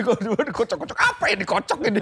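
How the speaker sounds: high, loud, laughing exasperation; half-laughing retold panic; laughing mock panic. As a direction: blurt it out loud through laughter. Retells brake panic while laughing.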